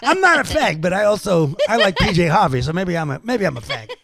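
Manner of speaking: imitated rock guitarist, pitch jumping up on the denial, then sinking; mock-defensive rocker, loud then giving in; comic impression of an interviewee protesting and wavering. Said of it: "actual quotes from my interview with [name]".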